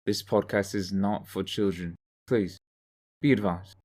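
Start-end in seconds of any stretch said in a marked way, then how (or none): none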